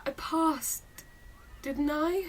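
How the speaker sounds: high, soft, tentative voice; subdued question. Acted. Daughter asking father whether she passed, soft and uncertain. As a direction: ask quietly, seeking confirmation.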